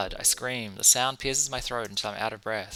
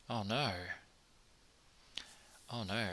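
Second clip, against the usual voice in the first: hushed dread; 12 dB quieter than usual. Acted. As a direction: barely voice the dawning dread. Narrator dreads what is behind her.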